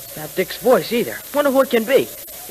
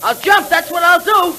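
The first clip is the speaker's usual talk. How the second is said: high, loud frightened cry; a cornered boy threatening to jump; panicked kid; desperate threat; 12 semitones higher, 5 dB louder than usual. Acted.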